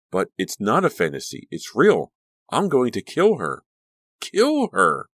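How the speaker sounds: rising to a high, loud cry; frenzied. Acted. Danielle losing control mid-fight, climbing to a wild high cry. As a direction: build from intent to wild frenzy.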